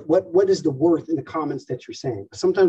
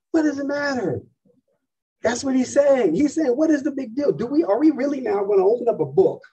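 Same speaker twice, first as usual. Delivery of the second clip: much higher, incredulous scoff; exasperated scoff; 9 semitones higher than usual. Real, not acted. Preacher mocking the tree riddle, incredulous and high. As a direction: toss it off as a big nothing.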